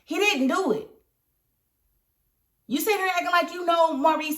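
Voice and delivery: high-pitched exasperated questioning; mad rant. Real, not acted. Scolding Calvin on TV for talking about Maurice, angry high voice. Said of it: "That's just making me mad".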